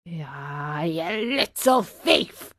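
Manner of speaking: slow, drawn-out accusation; growing from low and soft into a menacing cry; angry farmer. A narrator's reading. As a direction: draw it out like an angry farmer catching a culprit. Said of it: "said the farmer in an angry voice".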